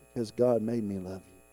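low, soft, sincere answer; tender reply. Retold or imitated. Wife answering her husband's question, tender and low. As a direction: retell it gently and quietly.

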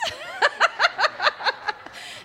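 high burst of real laughter. Real, not acted. Joking with listeners about raising teens, laughing. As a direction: laugh out loud at her own joke.

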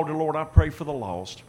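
ordinary talk, nothing marked

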